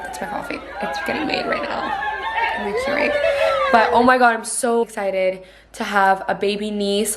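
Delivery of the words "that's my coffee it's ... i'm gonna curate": squeaky voice. Pretend. High squeaky playful aside.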